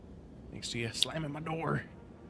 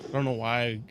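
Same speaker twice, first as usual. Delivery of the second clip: ordinary talk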